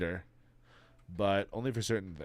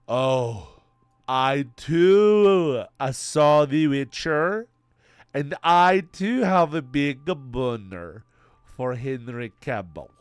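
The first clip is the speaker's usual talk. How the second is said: higher, louder and slow mock gushing; hammed-up girlfriend voice; 5 semitones higher, 11 dB louder, much slower than usual. Streamer mimics his girlfriend in a higher, louder, slow gushing voice. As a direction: ham up the girlfriend, higher and drawn out. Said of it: "She doesn't speak like that".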